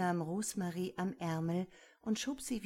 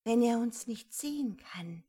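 usual speaking voice

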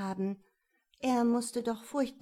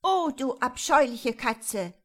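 loud, high, angry outburst; loud and indignant; angry scolding; 4 semitones higher, 9 dB louder than usual. A narrator's reading. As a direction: scold the cat furiously. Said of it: "die klang laut und zornig".

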